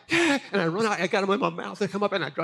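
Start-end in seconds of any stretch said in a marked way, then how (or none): 0.1-0.4 very high, loud excited yap of a yes; a dog thrilled to fetch; eager doggy yes. Retold or imitated. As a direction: yelp it like a happy little dog. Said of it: "my little doggy heart was like".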